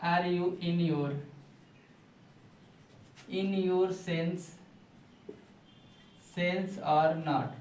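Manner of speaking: very slow, chunked, each piece given its own pause; slow dictation. Shown as a demonstration. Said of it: "होश में हो कि नहीं".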